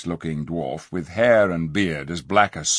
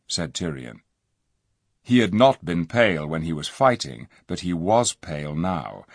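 ordinary delivery, nothing marked

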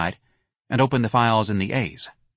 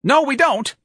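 very high, loud denial; piercing, flustered refusal; shrill; 6 dB louder, slower than usual. A narrator's reading. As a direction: snap it shrilly into the phone. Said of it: "answered in a shrill voice".

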